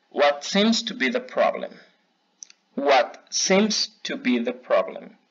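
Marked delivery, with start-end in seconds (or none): 2.8-5.2 slow, careful repeat for learners to copy; slow repeat model. Shown as a demonstration. Teacher repeating a phrase for students to echo, slowly. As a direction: draw it out so they can echo you. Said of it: "van a repetir después de mí".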